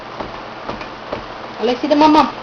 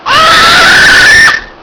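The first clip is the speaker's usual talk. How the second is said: sudden high, loud shout of alarm; a sharp yelp as something goes wrong; startled yelp; 5 dB louder than usual. Real, not acted. Parent feeding a toddler who nearly spills, startled high yelp.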